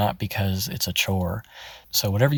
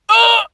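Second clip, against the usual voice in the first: sharp loud grunt like a punch to the chest; 9 dB louder than usual. Shown as a demonstration. Coach demos the grunt, like getting punched. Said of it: "make that grunt sound like".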